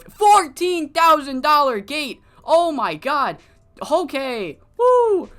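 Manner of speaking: loud, high, thrilled yelling; excited. Real, not acted. Player celebrating a record fourteen thousand dollar gate, real thrilled shouting. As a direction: burst out cheering at the huge number.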